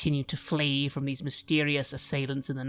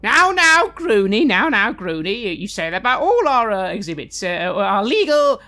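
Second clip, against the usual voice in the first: high, loud, flustered voice; flustered showman; 6 semitones higher, 8 dB louder than usual. Acted. Top-hatted showman fretting about the escaped goblin, flustered and loud. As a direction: babble in a panic, voice jumping up.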